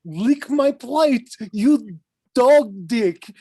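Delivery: high, slow, hammed-up German accent; mock German accent. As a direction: growl it out like a German exchange student. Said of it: "what the fuck kind of accent I was doing".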